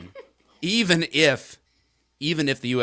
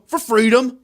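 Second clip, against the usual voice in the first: much higher, loud triumphant chant; shouted rally cry; 12 semitones higher, 9 dB louder than usual. Acted. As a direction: yell it like a protest chant.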